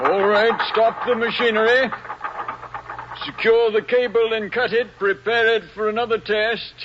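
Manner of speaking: called-out orders. Acted. Engineer giving orders on deck.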